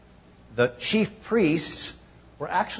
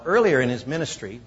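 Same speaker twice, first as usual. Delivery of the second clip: ordinary talk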